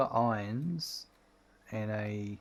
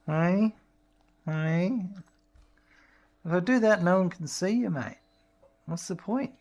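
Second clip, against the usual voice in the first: raised, loud playful voice to a dog; playful pet talk; 6 semitones higher, 5 dB louder than usual. Real, not acted. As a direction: tease the dog brightly. Playing with dog, higher, louder.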